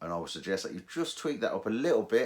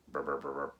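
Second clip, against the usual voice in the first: low buzzing brr imitating the detector; iron tone imitation; 4 semitones lower than usual. Shown as a demonstration. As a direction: buzz it out like a low machine tone. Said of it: "that is your iron tone".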